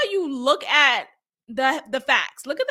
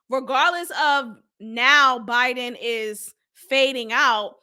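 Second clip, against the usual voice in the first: ordinary delivery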